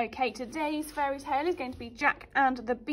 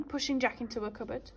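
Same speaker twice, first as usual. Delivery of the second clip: ordinary delivery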